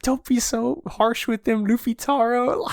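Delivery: high-pitched, mock-tearful plea; holding back tears. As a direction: imitate a child leader fighting back tears. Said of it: "holding back his tears".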